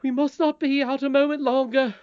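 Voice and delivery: high, loud, alarmed nurse voice; urgent nurse. A narrator's reading. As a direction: insist urgently as a worried nursemaid. Nurse urgently orders princess home.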